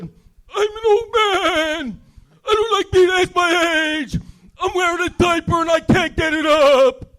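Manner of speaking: put-on very high, slow, shouted old man. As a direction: yell it like a cranky old geezer. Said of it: "my old man voice".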